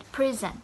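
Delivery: "present" said PREZ-ent, front-weighted; stress on first syllable. Shown as a demonstration. Teacher repeating the noun form, front-stressed "present". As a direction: punch the PRE of "present". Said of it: "the word present as a noun".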